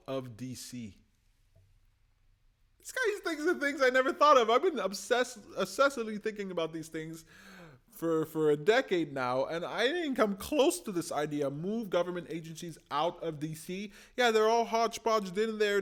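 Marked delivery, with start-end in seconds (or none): 2.8-11.5 much higher and louder, gushing; thrilled fan raving; excited. Real, not acted. Gushing about a new idea. 14.2-15.8 much higher and louder, gushing; thrilled fan raving; excited. Real, not acted. Gushing about a new idea.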